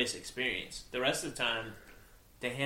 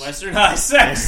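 laughing; 6 semitones higher, 13 dB louder than usual. Real, not acted. Echoes the name, laughing.

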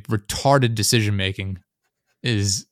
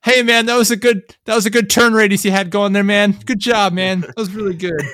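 high, louder, gushing fake praise; fake enthusiasm; 10 semitones higher, 5 dB louder than usual. Mocking coworkers praising a deadly turn, fake and high. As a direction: oversell the compliment in a squeaky high voice. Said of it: "The fake enthusiasm".

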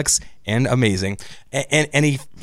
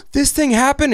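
surprised; 12 semitones higher than usual. Retold or imitated. Connie surprised by obvious twist.

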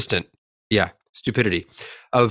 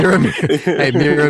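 higher, louder, through laughter; laughing; 4 semitones higher, 4 dB louder, much faster than usual. Pretend. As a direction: giggle through the words. Playful laughing repeat.

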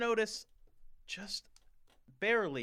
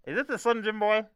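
annoyed operator; 7 semitones higher, 10 dB louder, faster than usual. Acted. Operator annoyed at prank caller.

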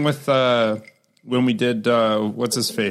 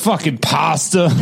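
mock Boston accent; 3 semitones higher, slower than usual. Joking about Boston and New York, slow mock Boston accent. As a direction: draw it out like a Boston guy. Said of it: "Boston and New York are like the same thing".

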